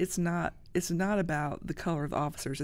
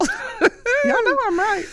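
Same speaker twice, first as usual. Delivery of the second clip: high, slow, eerie put-on voice, much louder; mock scary voice; 11 dB louder, much slower than usual. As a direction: drag it out like a spooky ghost. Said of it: "Woo, scary".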